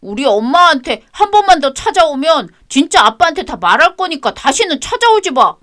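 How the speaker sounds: high, sulky schoolboy answering back; child's defiant voice. Acted. Grandson snapping at his grandmother, high child voice. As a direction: talk back like a stubborn kid.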